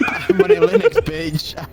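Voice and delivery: laughing. Retold or imitated. Quoting a meme line, laughing.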